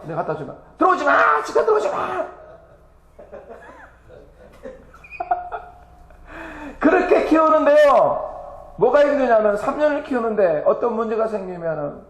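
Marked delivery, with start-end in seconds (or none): 0.8-2.2 high mock yell, rising higher; wife's shout. Wife shouting him out. 3.2-8.3 high, slow laugh breaking into tears; laughing and crying. Real, not acted.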